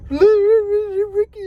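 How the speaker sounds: a child crying to her mom, hammed-up wail; fake sobbing. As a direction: sob the name out through pretend tears. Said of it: "I let the waterworks go".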